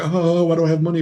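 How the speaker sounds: high-pitched mock spender; mocking imitation. Store owner imitating customers who burn money, mocking.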